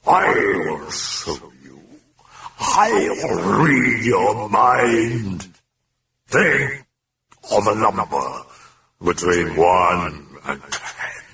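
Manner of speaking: slow, strange demon drawl. Acted. Demon taunts Karras, slow and eerie. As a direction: speak as the mocking demon.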